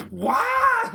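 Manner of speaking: high squeak of shock; a sudden high disbelieving what. Retold or imitated. Retold shocked reaction.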